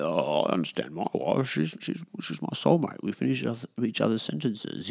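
soft mocking lovesick bloke; soppy husband voice. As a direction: coo it like a smitten husband. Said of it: "Blokes like that".

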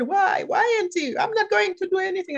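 nephew's high, hammed-up protest. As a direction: whine it like a reluctant young nephew. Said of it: "He said".

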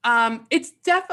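usual speaking voice

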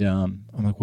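ordinary delivery

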